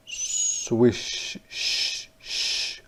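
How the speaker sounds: soft unvoiced swish; swishing sound. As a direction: breathe out a swishing tail. Fish swimming sound.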